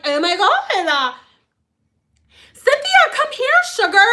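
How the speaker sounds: put-on high, sugary call of an old lady; sweet old lady calling. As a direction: coo her name up high like a doting grandma. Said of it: "I'd be like".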